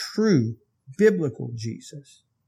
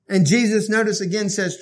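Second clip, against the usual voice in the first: ordinary talk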